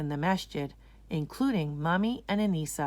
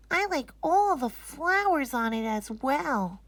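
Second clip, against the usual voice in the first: put-on little girl voice; 8 semitones higher than usual. Anisa arriving at the masjid, amazed little girl.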